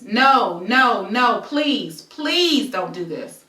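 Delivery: loud pleas, the last slow; retold pleading yell. Fighting him off, loudly begging him to stop. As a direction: cry out no, then beg please. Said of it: "as I yell".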